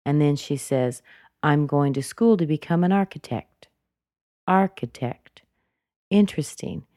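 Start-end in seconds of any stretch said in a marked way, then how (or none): 1.4-3.7 "architect" dropping to close the sentence; falling, finished intonation. Shown as a demonstration. Teacher modeling the end of an introduction, fall on "architect". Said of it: "But she used a downward intonation". 4.5-5.4 "Architect" starting high and dropping; falling, finished intonation. Shown as a demonstration. Teacher isolating the final word, falling "Architect". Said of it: "She's finished".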